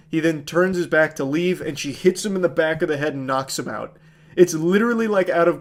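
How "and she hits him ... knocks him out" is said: ordinary talk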